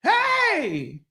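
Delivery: high-pitched call to stop a fight. Retold or imitated. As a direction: shout high to break it up. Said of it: "I'm like".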